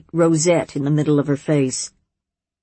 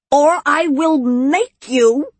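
threat; 10 semitones higher, 3 dB louder, slower than usual. A narrator's reading. Witch threatens the monster.

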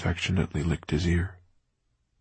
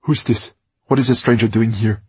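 loud, higher, sharp challenge; abrupt and angry; 5 semitones higher, 8 dB louder than usual. A narrator's reading. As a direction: bark at the intruder. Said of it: "abrupt and angry".

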